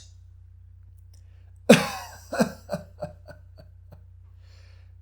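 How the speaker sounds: big hearty laughing fit; full-throated laugh. Acted. Daimyo laughing at Suenaga's boast, full-throated laugh. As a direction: let out a long barking laugh. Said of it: "barked out a full-throated laugh".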